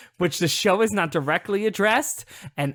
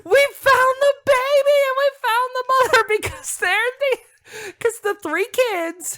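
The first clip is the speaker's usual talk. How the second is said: very high, loud jubilant scream; overjoyed squeal breaking into laughter; real excitement; 6 dB louder than usual. Real, not acted. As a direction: shriek the great news, laughing. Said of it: "I'm so excited".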